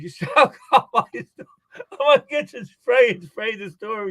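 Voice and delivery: high voice, laughing through the words, imitating him; laughing manager. Store manager joking about smell.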